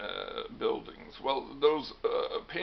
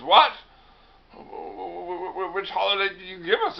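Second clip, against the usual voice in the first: higher, stammering incredulous question; acted disbelief; 8 semitones higher, 8 dB louder than usual. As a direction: stammer in mock shock, then rush the question. Hiawatha shocked at used holiday.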